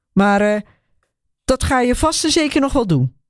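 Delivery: a hint of a defiant son, taunting; bitter young man. A narrator's reading. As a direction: throw the reproach back at her. Son lashes out at Hilly.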